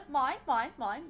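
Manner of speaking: playful British-accented gull imitation; Finding Nemo seagulls. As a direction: chant "mine" as a greedy cartoon bird. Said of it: "like those birds from, um, Finding Nemo".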